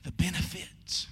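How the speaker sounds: solemn low whisper. Real, not acted.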